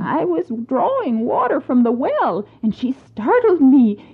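high, excited servant gossip; startled servant. Acted.

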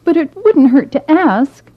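put-on a shy girl, apologising; young maiden.

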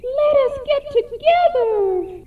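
very high, eager echo; echoing repeat. Acted. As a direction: sing it back high like an echo. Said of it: "she repeated".

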